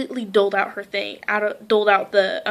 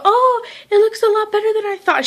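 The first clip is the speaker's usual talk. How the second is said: put-on much higher, light girlish voice; 11 semitones higher, 4 dB louder than usual. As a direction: play the small hairdresser, pleased.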